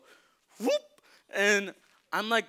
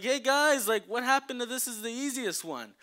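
higher, louder protest call; 7 semitones higher, 4 dB louder than usual. Retold or imitated. To the group up ahead, mock indignant call.

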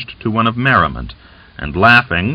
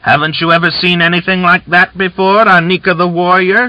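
much higher, loud mocking shout; 10 semitones higher, 4 dB louder than usual. A narrator's reading. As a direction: jeer it at the top of her voice. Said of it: "she shouted".

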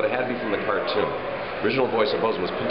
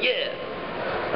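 Quagmire impression; 9 semitones higher, 4 dB quieter than usual. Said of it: "Or maybe doing Quagmire".

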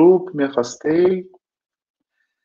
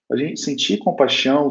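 usual speaking voice

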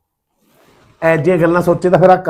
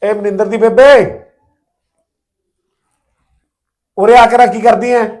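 loud call across the house, higher pitched; 6 semitones higher, 6 dB louder than usual. Acted. Husband calls his wife in from another room, loud and high. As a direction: shout for her to come over.

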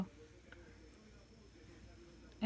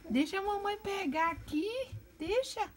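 high coaxing pet voice; high baby voice pleading "deixa"; baby talk coaxing; 10 semitones higher than usual. Acted. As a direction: sweet-talk the bird into being held. Coaxing the bird.

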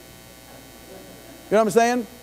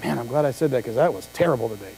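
much lower, softer, quick mutter; muttered complaint; 9 semitones lower, 5 dB quieter, faster than usual. Retold or imitated. Churchgoer complaining to his wife after service, low quick mutter. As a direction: mutter it fast under your breath to your wife. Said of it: "say to your wife".

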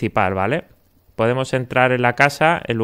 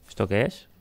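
normal talking voice

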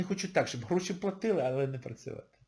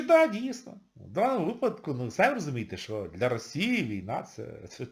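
ordinary talk, nothing marked

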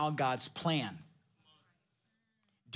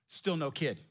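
ordinary talk

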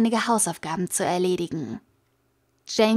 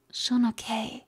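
leise ins Ohr gehaucht, ganz nah; geflüstert; 6 dB quieter than usual. A narrator's reading. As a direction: sanft ins Ohr flüstern. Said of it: "flüsterte dann in sein Ohr". (leise geflüstert ins Ohr des Freundes)

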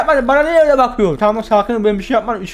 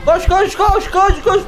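panicked yelling; 9 semitones higher, slower than usual. Real, not acted. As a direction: yell at yourself to run in panic. Panicked fleeing in horror game.

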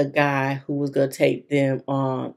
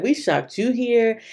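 pitched up, mocking the rescuers; higher mock rescuer voice; 7 semitones higher than usual. Retelling what the rescuers told the friend, mock higher voice. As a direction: raise the voice to poke fun at it. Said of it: "So they was like".

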